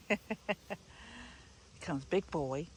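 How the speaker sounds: ordinary talk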